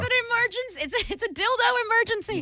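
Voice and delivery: high, squeaky play-panic; mock urgent plea. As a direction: whine it like a fake emergency. Mock plea about batteries.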